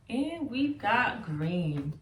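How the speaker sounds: ordinary talk, nothing marked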